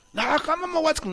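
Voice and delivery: mock a father too proud to apologize; a parent refusing to say sorry to the kids. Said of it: "disculparte de tus hijos".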